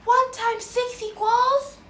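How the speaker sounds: high, loud, slow prompt; tiny marching ant. Shown as a demonstration. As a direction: lead it in a tiny voice. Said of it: "This time, use a tiny voice".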